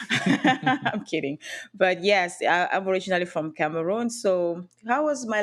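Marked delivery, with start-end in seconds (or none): 0.0-1.4 laughing. Real, not acted. Jokes after geography quip.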